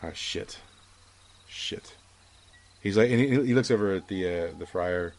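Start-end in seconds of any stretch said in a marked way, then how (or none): 0.0-1.9 whispered, dismayed curse under his breath. Acted. Ted realizes what he has done, whispered dismay. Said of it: "Ted's like".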